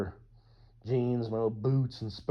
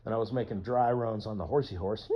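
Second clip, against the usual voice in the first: normal talking voice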